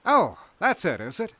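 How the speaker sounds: high, loud opening that eases down; flattering. A narrator's reading. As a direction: humour them with a flattering lilt. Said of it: "in that flattering voice".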